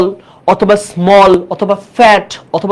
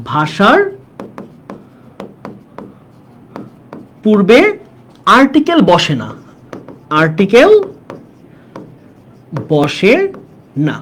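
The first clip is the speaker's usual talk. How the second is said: note dictation; slower than usual. Shown as a demonstration. Teacher has students take down a grammar note, halting dictation. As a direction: piece by piece, long pauses between words. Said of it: "এখানে ছোট্ট দুইটা note নাও তোমরা".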